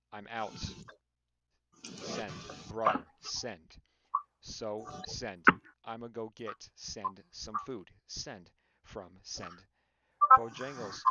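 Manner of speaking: chopped message with SEND dropped in after each fragment; Robert. Retold or imitated. Mocking how a friend types, haltingly with SEND between fragments. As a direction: break it up, a separate SEND after every few words. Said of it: "the way that Robert would type is like".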